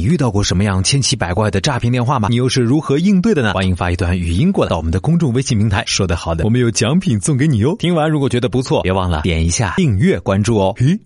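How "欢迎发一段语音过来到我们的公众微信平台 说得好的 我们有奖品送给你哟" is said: normal talking voice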